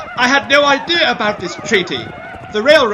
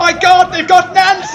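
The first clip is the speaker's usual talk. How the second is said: a loud, high cry of alarm; alarmed shout; 5 semitones higher, 5 dB louder than usual. Acted. Man crying out as raiders seize Nancy, loud panicked shout.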